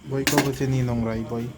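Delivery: normal talking voice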